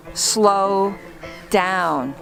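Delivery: ordinary delivery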